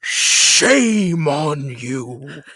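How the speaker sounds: imitating Frasier's grave shame on you; solemn rebuke. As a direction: drag out each word like a stern reproach. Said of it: "turns to the other family members and goes".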